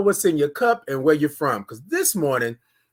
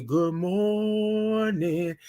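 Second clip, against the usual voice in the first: very slow, stretched "Good morning"; teaching the proper slow morning greeting; drawn-out greeting; 3 semitones higher, 5 dB quieter, much slower than usual. Shown as a demonstration. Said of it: "That's how you gotta say it".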